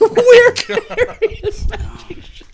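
very high, slow laughing echo; laughing mock repeat. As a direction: squeal it slowly through laughter. Mocking repeat amid laughter.

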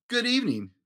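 a high silky monster gentleman. Acted. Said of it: "he said in a high, silky voice".

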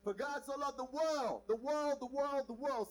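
world hammered loud each time; stress on world. Shown as a demonstration. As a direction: keep punching the world. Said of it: "Say the world".

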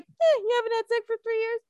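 high, fast shrug of a voice; jaded older self. Pretend. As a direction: toss it off like nothing matters. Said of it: "when I'm my age, I'd be like".

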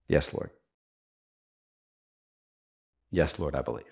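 relieved surrender, low and hushed; Martha. Acted. Preacher voices Martha answering Jesus, deeply relieved. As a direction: let it out low and soft, with long pauses. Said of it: "there's this deep sigh of relief".

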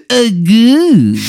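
loud, high shocked group groan; unison groan. Acted. The inmates watch Robin break his neck, a loud high groan. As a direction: react in unison to a gruesome fall.

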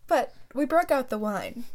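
ordinary talk, nothing marked

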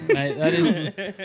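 laughing. Real, not acted.

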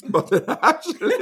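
high, loud laughing. Real, not acted. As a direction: laugh through the words. Laughing at candy joke.

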